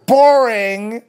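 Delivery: high, loud, drawn-out taunt; loud mock taunt. Taunting the staff who were acting in unison. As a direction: sing out the word to mock them. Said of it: "And I said that".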